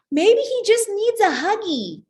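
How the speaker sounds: put-on sweet little kid. Classmate suggesting a hug, high sweet kid voice. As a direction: squeak it out like a helpful five-year-old.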